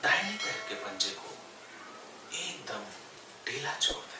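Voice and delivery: unhurried yoga nidra guidance with long pauses; guided meditation. Real, not acted. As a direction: guide them gently into stillness, phrase by phrase. Leads yoga nidra body relaxation. (ठहर-ठहर कर योग निद्रा का निर्देश)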